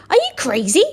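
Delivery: high, loud scornful woman; shouted. Acted. Woman shouts at the tall man's offer, high and loud. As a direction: shout it in disbelief. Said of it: "shouted a woman".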